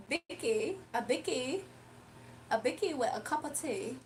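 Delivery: light put-on British accent. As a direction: play a posh British host with a cup of tea. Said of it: "I've been talking like that, like, British a little bit".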